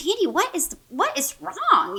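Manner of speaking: high-pitched retold alarmed question; retold concerned supervisor. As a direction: imitate a supervisor asking what's wrong. Supervisor reacting to her screaming.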